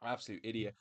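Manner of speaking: soft, dismissive under breath; muttered insult. Retold or imitated. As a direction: mutter it low to yourself. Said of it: "I was like".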